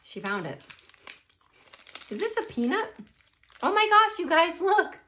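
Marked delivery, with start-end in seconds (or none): 2.1-5.0 excited surprise. Real, not acted. Finds a whole peanut in snack.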